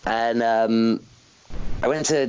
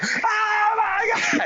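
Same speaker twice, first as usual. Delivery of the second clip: ecstatic shriek; much slower than usual. Retold or imitated. Retelling hearing his entrance music.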